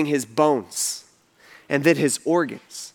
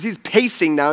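normal talking voice

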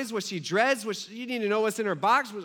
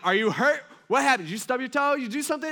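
frantic questions; 5 semitones higher, 4 dB louder than usual. Retold or imitated. Husband rapidly checks off worries.

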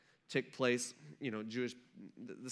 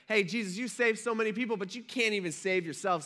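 higher, louder mocking jeer at the cross; mocking insult; 7 semitones higher, 3 dB louder than usual. Passersby mocking Jesus on the cross, higher mock jeer. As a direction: sneer up at him as a mock yell. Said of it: "yelling insults at him".

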